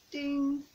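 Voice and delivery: little bell chime; bell ding. Shown as a demonstration. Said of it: "tell you what the song is by saying ding".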